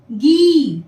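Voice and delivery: गी with a clearly stretched long ii; drawn-out long ii. Shown as a demonstration. Teacher showing pupils गी with a long ii. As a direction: stretch the vowel of गी. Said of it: "ग में जुड़ने से होगा".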